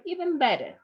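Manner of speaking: normal talking voice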